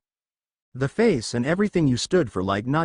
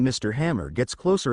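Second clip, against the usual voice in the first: ordinary delivery, nothing marked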